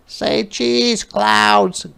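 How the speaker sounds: put-on high little girl voice, cheerful; a small girl snapping photos, high and bright; little girl playing photographer; Ruthie's voice.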